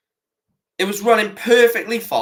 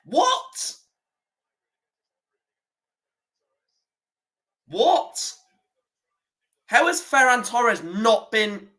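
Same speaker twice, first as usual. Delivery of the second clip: high, rising, stunned what; can't believe what he just saw; disbelieving what; 5 semitones higher, 3 dB quieter, much slower than usual. Real, not acted. Reacting to a referee decision.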